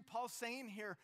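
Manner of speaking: ordinary talk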